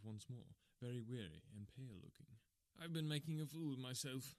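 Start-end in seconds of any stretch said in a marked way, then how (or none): 2.8-4.3 gasped. A narrator's reading. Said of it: "he gasped".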